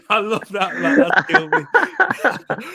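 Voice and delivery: high, loud real laughter through the words; helpless laughing, pitch shooting up; cracking up. Real, not acted. Host tickled by co-host's Richarlison joke, cracking up.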